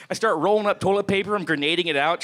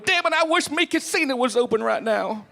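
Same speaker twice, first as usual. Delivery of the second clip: mock drunk; 8 semitones higher than usual. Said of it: "No one's ever wasted going".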